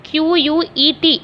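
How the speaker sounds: letters spelled one by one, slowly; spelling out letters. Shown as a demonstration. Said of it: "तो मैं बता दे रही हूँ". (धीरे धीरे अक्षर बोलकर स्पेलिंग)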